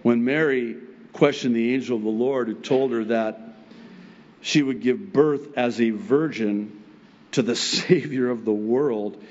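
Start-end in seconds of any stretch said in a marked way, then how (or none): none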